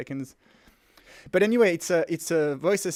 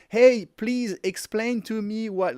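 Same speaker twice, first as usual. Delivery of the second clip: slow, over-careful voice-command delivery, pitched up; slow dictating; 6 semitones higher, 6 dB louder, slower than usual. Retold or imitated. Host mocking how people talk to assistants, slow and careful. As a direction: spell it out for a machine, deliberately and slowly. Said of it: "you don't feel like you're like".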